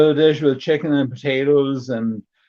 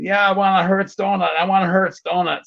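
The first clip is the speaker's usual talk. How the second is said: mock bratty kid; 6 semitones higher than usual. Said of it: "And the kid".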